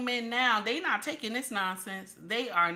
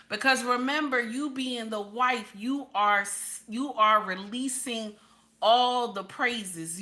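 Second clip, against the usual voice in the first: usual speaking voice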